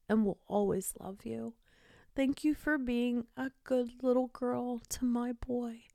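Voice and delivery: soft, slightly high, tender goodbye; softly reassuring, lovingly; tender farewell. Retold or imitated. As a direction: say goodbye gently to a beloved dog. Saying farewell to dying dog.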